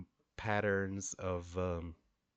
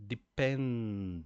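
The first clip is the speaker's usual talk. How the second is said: "Dependence" drawn out slowly, PEN stretched and louder; syllable by syllable; 2 semitones higher, 3 dB louder than usual. Shown as a demonstration. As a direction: stretch "Dependence" into three slow beats. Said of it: "mime the syllables in your hands".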